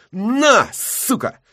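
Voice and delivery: high burst then a breathy hiss; breathed out. Acted. Zheka exhales it while kicking, breathy and high. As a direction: blow it out with the kick. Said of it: "выдыхая".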